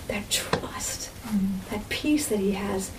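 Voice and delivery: hushed, whispered awe. Real, not acted. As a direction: breathe it out as a whisper. Awe at Jesus's trust.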